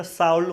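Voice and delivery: should misread as शाउल, "shauld"; children's misreading of should. Retold or imitated. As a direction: say शाउल with an "ow" vowel. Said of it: "तो इसको शाउल पढ़ लेते थे". (should को बच्चों जैसा ग़लत शाउल पढ़ें)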